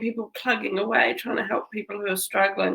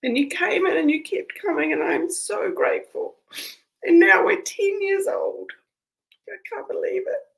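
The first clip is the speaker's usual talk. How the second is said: higher, teary voice, choked with gratitude; crying while speaking; 11 semitones higher than usual. Real, not acted. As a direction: push the words through real tears. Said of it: "looking at myself crying".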